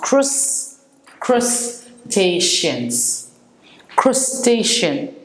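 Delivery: slow crus-TAY-shun, "crustacean" said syllable by syllable; careful pronunciation. Shown as a demonstration. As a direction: spell out "crustacean" slowly, stress on TAY. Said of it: "it's pronounced".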